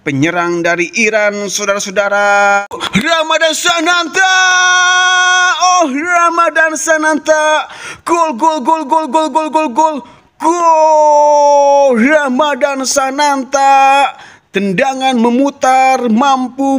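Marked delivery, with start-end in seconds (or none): 2.9-14.1 stretched shouted goal call. Acted. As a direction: scream the scorer's goal.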